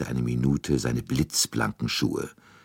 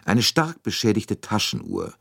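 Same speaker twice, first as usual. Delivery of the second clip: measured formal speech to the room; formal address; 3 semitones higher than usual. A narrator's reading. Poirot addressing all the passengers, formal and measured. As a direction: lay out the case to everyone, formally.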